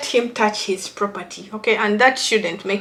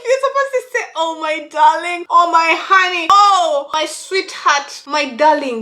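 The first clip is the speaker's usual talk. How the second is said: higher, pleading pet names; pretend wife; 7 semitones higher, 4 dB louder than usual. Wife telling husband what to call her, higher pleading. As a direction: coo the pet names demandingly. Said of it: "And be like".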